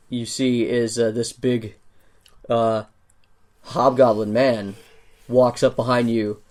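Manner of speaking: ordinary talk, nothing marked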